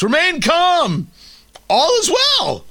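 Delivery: high, slow, panicked cry for calm; Kevin Bacon in Animal House. Acted. Host mimicking a movie scene of false reassurance, hammed-up panic. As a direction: stretch it out high over the crowd, hammed-up. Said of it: "it's like Kevin Bacon in, uh, in Animal House".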